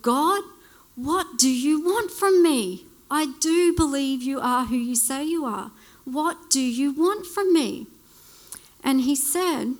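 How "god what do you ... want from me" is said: yelled out. Retold or imitated. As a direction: call out to God, fed up and pleading. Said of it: "And I just yelled out".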